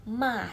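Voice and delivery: MA tossed down like throwing something away; "ma" in one downward throw; falling fourth tone. Shown as a demonstration. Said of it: "seperti kayak kita buang sesuatu".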